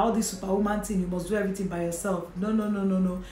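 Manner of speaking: normal talking voice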